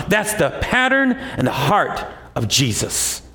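usual speaking voice

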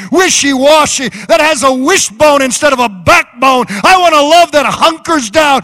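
loud, fast, rising preacher's cry; full-force revival prayer; fervent preaching. Real, not acted.

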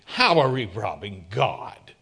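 a people scoffing at God's charge; indignant. Retold or imitated. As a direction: throw the question back, affronted. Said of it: "and they asked indignantly".

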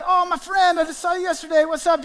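high, eager hello to a friend; gushing greeting. Pretend. Pastor greeting a friend seen yesterday, gushing. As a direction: pour on the delight.

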